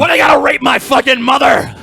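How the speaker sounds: sudden shouted exasperation; angry outburst. Real, not acted. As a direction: shout it as an outraged question. Outburst, others call him anger personified.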